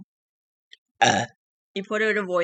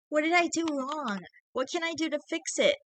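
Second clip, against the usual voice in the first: put-on high, strained girlfriend's plea; 10 semitones higher than usual. A narrator's reading. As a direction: hold back tears, pitched up. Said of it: "she asked in a strained voice".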